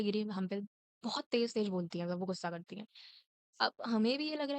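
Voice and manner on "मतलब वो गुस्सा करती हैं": ordinary delivery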